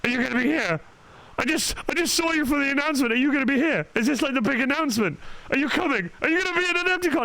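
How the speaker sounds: high, fast excited questions; eager fans. Retold or imitated. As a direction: pile on the questions like an eager fan. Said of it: "friends being like".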